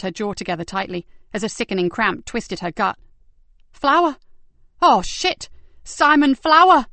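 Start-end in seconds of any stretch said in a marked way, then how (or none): 3.7-6.9 sudden high, loud cry of horror; loud horrified exclamation; shocked outburst. Acted. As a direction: blurt the name out in alarm. Shocked realisation in police corridor.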